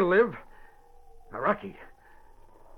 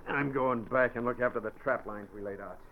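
contemptuous; 7 semitones lower, 3 dB quieter than usual. Acted. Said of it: "spoke contemptuously".

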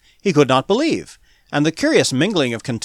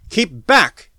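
loud, higher warning shout; yell; 5 semitones higher, 5 dB louder than usual. A narrator's reading. Smith swinging up his gun at the mob, warning yell. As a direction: yell a sharp order to stay away. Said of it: "he yelled".

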